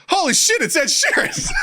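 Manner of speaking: very high, squealed amazement; imitated shriek of disbelief; astonished audience. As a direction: squeal it like a starstruck fan.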